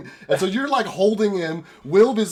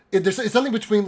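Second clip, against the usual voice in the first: ordinary talk, nothing marked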